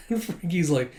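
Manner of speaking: normal talking voice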